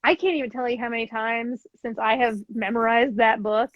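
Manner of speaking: ordinary delivery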